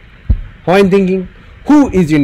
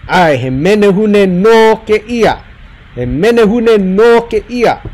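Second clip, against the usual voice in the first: menehune no ke ia with no pulled long and falling; stress on no; 3 semitones higher than usual. Shown as a demonstration. Teacher showing students the emphasis, no stressed. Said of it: "The no just emphasizes".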